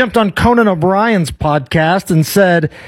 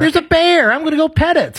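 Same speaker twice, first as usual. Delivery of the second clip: cheerful oblivious hiker; 8 semitones higher than usual. Retold or imitated. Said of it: "the whitest phrases".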